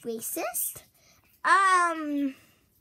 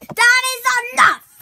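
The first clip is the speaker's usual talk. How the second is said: high, loud, fed up; angry shout; 9 semitones higher, 14 dB louder than usual. Acted. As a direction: snap it out loud.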